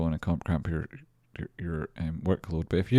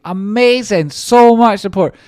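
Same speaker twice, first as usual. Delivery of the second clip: much higher and louder, thrilled cry; overjoyed shout; 13 dB louder than usual. Real, not acted. Streamer thanking supporters at stream end, overjoyed shout. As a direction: yell it out in pure delight. Reacting to donations during credits.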